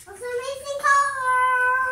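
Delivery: slow, loud, insistent call. Real, not acted. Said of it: "repeats louder".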